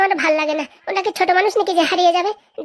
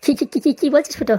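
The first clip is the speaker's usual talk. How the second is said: rapid stuttered repeats of the first word; stammering. Acted. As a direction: stammer it out in disbelief. Stammers at a shocking request.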